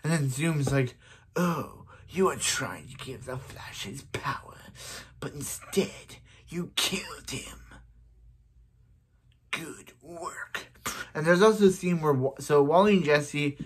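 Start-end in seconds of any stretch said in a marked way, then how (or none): 1.4-10.7 slow, whispery villain voice; soft menacing whisper; Zoom voice. Acted. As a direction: taunt in a drawn-out hush. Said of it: "I do a very good Zoom voice".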